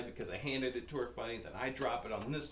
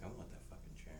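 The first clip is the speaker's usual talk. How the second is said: whispered dog thought; 12 dB quieter, faster than usual. Retold or imitated. Voicing the dog's grumpy thought.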